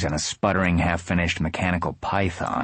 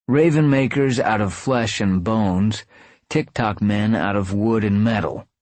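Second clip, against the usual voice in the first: ordinary delivery, nothing marked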